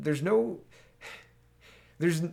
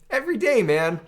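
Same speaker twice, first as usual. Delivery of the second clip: much higher and louder, fed-up exclamation; exasperated outburst; 7 semitones higher, 6 dB louder than usual. Real, not acted. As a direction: throw it up in exasperation. Exasperated mock of Biden gaffes.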